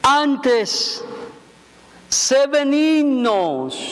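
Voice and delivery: very slow reading. Shown as a demonstration. Pastor reading Ephesians to the congregation very slowly. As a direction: read the verse word by word with long pauses. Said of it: "Se lo voy a leer bien despacito".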